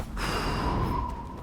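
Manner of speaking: long soft sigh. Real, not acted. Player pausing before exploring further, soft sigh. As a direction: let out a soft, voiceless breath.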